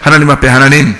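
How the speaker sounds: ordinary talk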